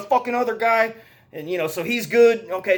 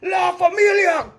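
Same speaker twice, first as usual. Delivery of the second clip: much higher hammed-up yell of La Familia; over-the-top gangster war cry; mock battle cry; 11 semitones higher than usual. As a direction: bellow it like a movie thug swinging a sink. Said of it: "picks up the sink and goes".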